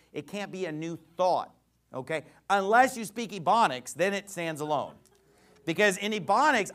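ordinary talk, nothing marked

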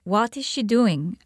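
normal talking voice

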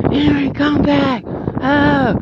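high imitating yell, stretched out slow; mock scream. Boss chasing him across the parking lot, mock high yell. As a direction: ham up the boss's panicked cry. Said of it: "came running and screaming out the E R".

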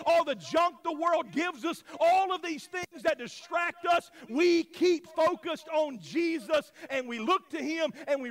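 loud, high, driving sermon delivery; full-force preaching. Real, not acted. Pastor urging the church to stay focused on Jesus, impassioned preaching. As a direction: preach it hard, punching each phrase.

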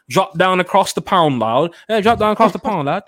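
loud, high put-on British accent; mock British accent. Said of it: "Was that your Peppa Pig accent?".

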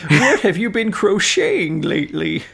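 put-on high, loud old-timer voice; old American man. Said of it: "old American man".

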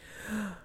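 short, high gasp of delight; surprised gasp. Acted. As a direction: gasp at a wonderful taste.

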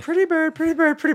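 very high squawky bird voice; parrot voice. Retold or imitated. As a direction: squeak it out like a parrot. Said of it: "It goes".

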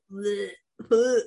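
gagging, retching bleh; dry heaving. Real, not acted. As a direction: fake retching noises of disgust. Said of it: "put a warning on this ... vomiting sounds".

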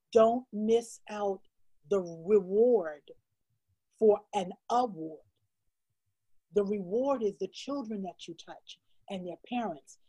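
slow, weighty delivery; slow solemn divine words. Retold or imitated. Author retelling God's message to her, slow and solemn. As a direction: let each word land slowly like a revelation. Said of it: "And he says".

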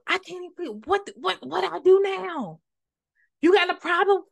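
high-pitched, exasperated challenge; acted indignation. Acting out a touchy person's challenge, high and indignant. Acting out someone picking a quarrel.

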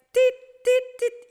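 high, sharp beeps; imitated monitor beeps. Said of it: "y ya de que". (pitidos agudos del monitor)

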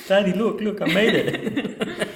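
put-on high, slow, laughing kid voice; excited little kid. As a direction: play a proud child showing off. Acting proud kid showing cauliflower.

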